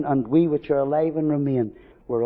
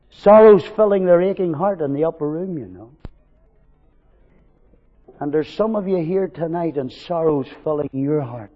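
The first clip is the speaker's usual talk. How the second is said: normal talking voice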